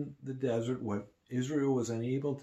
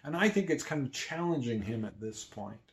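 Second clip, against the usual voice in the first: ordinary talk, nothing marked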